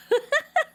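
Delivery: quick high burst of a laugh; a bright giggle at herself; short high laugh. Real, not acted. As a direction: laugh it off, amused. Laughs at her own voice.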